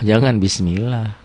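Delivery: ordinary talk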